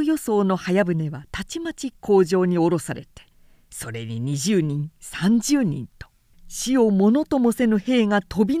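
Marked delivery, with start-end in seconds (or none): none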